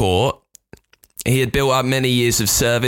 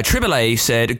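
ordinary talk

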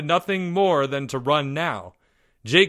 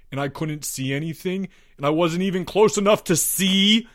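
raised, stumbling, building urgency; panicked confession; 7 semitones higher than usual. A narrator's reading. Albert explains his panic to the boys, urgently.

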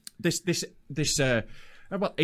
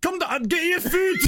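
higher Glaswegian delivery-man call; Glaswegian accent; 14 semitones higher, 3 dB louder than usual. Retold or imitated. Delivery man rings a customer to come down, Glaswegian accent. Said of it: "I didn't wanna do the accent".

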